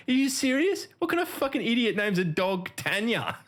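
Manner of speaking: high-pitched mock disbelief; incredulous. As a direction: imitate a workmate who can't believe it, voice pitched well up. Retelling workmate's incredulous reaction.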